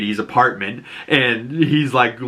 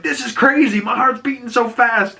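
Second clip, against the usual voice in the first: high, thrilled quote; giddy getaway driver; adrenaline rush; 11 semitones higher than usual. Retold or imitated. Adebayo driving the escape car, high and giddy. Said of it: "She's like".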